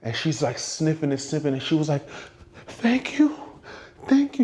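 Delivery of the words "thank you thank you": high, emotional mock tearful thanks. Fan thanking the singer for the rag, tearful imitation. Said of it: "She starts crying".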